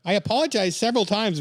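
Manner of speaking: high-pitched put-on Canadian accent. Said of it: "Canadian voice".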